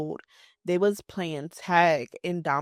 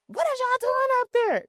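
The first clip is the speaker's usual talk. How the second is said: put-on high, raised holler up to the kids; mock holler, pitched way up; calling upstairs; 4 dB louder than usual. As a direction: imitate a grown-up yelling upstairs. Grandparent calling up the stairs.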